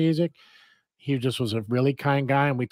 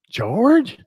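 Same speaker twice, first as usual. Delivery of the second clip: higher, louder, rising query on the name; puzzled question; 9 semitones higher, 6 dB louder than usual. Retold or imitated. Storyteller replaying his confusion to the customer, puzzled question. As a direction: act out a baffled "George?".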